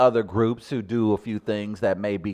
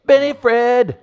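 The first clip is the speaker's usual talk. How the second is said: high, loud, delighted yell; excited shout; 16 dB louder, slower than usual. Real, not acted. Host gleefully shouting the columnist's nickname. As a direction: cheer his name out.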